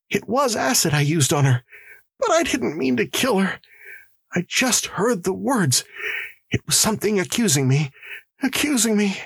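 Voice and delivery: high, distressed pleading; shivering confession. Acted. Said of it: "He mumbled and was silent".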